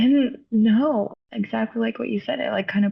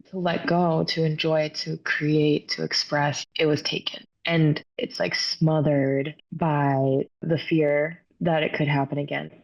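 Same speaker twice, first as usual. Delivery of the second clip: normal talking voice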